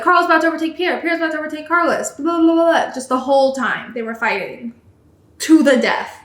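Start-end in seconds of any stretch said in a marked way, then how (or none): 0.0-2.9 higher, mocking play-by-play; mock commentary. Mocking the commentators on the endless battle, higher mock voice. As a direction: rattle it off like a bored commentator. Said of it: "every time it's like".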